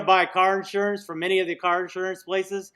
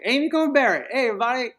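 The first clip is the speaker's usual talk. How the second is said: mock cheerful greeting; 6 semitones higher, faster than usual. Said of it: "they all were like".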